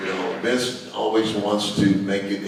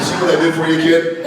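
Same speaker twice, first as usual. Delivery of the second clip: loud and laughing; laughing punchline; 9 semitones higher, 8 dB louder than usual. Retold or imitated. Teasing the grown-up kid through loud laughter. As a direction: crack up while delivering it.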